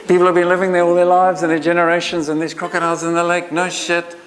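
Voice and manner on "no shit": higher, laughing through the words. Real, not acted. Speaker repeats the sarcastic punchline to the audience, laughing. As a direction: let the laugh break into the line.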